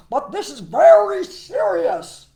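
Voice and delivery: loud, high, slow frog voice; emphatic, urgent storybook frog; chief frog. Acted. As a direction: hammer each word as the head frog. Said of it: "said the chief frog".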